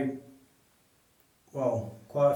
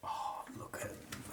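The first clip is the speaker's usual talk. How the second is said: whisper; 12 dB quieter, slower than usual. Real, not acted. Whispering in spooky abandoned building.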